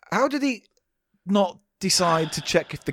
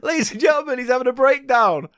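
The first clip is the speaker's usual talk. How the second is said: mock presenter; 7 semitones higher than usual. Mock presenter announcing breakdown.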